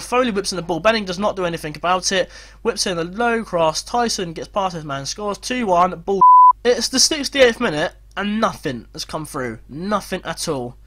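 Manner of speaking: normal talking voice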